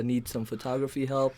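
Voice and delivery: normal talking voice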